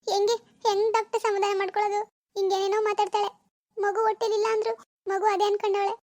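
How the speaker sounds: a mother who cannot bear to see her daughter so; distressed mother. Acted. Mother voiced beside doctor.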